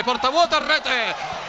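rising goal shout; goal call. Real, not acted. (telecronaca da gol)

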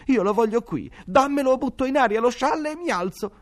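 a spoiled son threatening his mother; sick boy insists on having the piglet in bed; spoiled demanding boy. A narrator's reading.

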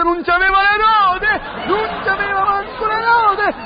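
high, drawn-out cry of disbelief; mock outrage. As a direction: wail it out in comic indignation. Outraged discovery of missing wheels.

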